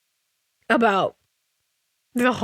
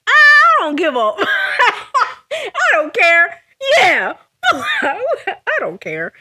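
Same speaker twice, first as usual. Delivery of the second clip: laughing; 8 semitones higher, 6 dB louder, much slower than usual. Real, not acted. Laughing off a confession.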